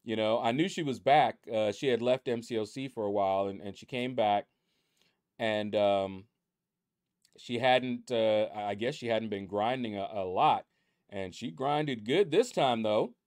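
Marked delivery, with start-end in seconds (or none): none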